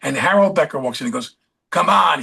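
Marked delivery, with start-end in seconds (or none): 1.7-2.2 a louder, higher, hoarse New York bark of a director; raspy New York director. Retold or imitated. Director calling into the bedroom on set, loud hoarse New York voice. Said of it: "He had a very hoarse, raspy New York voice".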